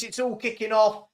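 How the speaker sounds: normal talking voice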